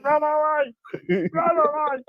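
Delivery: mock panicked cry. Fleeing Israel in the story, a high mock panic. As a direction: squeal it like a cartoon coward.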